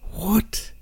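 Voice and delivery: high, whispered incredulous "what"; exasperated disbelief. Real, not acted. Reader baffled by the wordy sentence, whispered disbelief.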